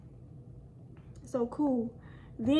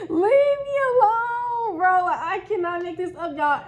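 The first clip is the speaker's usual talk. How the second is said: higher, louder, slow cry of disbelief through laughter; real laughing exasperation; 7 semitones higher, 7 dB louder than usual. Real, not acted. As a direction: wail it out while still laughing.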